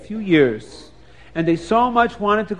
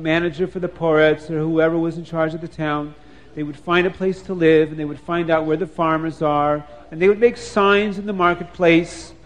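plain reading voice

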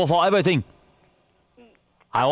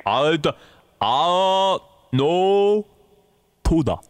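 drawn-out words with long pauses; slow and clearer; much slower than usual. Pretend. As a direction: spell it out word by word. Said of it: "Just speak a little clearer".

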